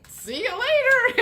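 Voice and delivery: high, loud mock-cheery send-off; breezy goodbye. The other person dismissing Gemini, high mock-cheery. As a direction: wave them off sweetly.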